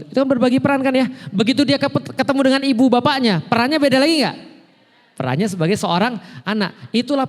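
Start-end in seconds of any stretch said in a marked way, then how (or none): none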